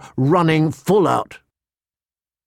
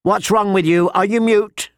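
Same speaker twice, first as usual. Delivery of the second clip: high, insistent scolding; demanding; 7 semitones higher than usual. A narrator's reading. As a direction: press for an answer in a raised pitch. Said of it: "the Septa demanded".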